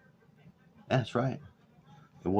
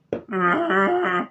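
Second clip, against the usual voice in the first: high cackle; 12 semitones higher, 5 dB louder than usual. Real, not acted. Laughs at own joke.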